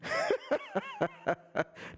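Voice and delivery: sudden high-pitched burst of laughter; big laugh. Real, not acted. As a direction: laugh at the troll. Streamer mocking a chat troll.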